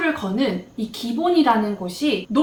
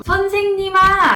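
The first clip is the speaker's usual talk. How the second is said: slow, higher, louder cry of "선생님아"; loud drawn-out call; 8 semitones higher, 6 dB louder, slower than usual. Retold or imitated. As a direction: call out across the ward. Calling out to the preceptor next day. (다음 날 신규가 선생님을 반갑게 길게 부르는 큰 소리)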